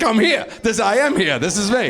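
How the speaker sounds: mock eager acquaintance. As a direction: puff up like someone thrilled to be seen.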